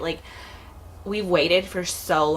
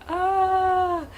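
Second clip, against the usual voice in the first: high, held overwhelmed ah; mock overwhelmed ah; overwhelmed gasp; 14 semitones higher than usual. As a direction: let out a high fanning gasp. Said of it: "I was like".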